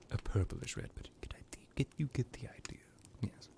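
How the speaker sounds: very soft, breathy musing; murmured to oneself, almost voiceless; trailing into a whisper. Acted. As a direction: let it fade to a whisper. Vampire pining for his wine.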